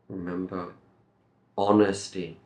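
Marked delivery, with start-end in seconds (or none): 1.6-2.4 honesty begun on the vowel, "on-esty"; silent H. Shown as a demonstration. Teacher shows no H sound, honesty with silent H. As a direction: drop the H from honesty. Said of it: "no H sound here".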